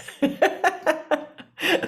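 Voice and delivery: high, bubbly chuckle; laugh. Real, not acted. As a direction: laugh at your own little joke. Laughs at own wild-guess joke.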